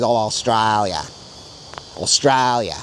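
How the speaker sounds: Australia stretched into a broad Australian "Ostrailya". Shown as a demonstration. To viewers, contrasting with the Sydney way, broad Australian accent. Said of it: "A more broad Australian way is".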